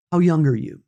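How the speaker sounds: usual speaking voice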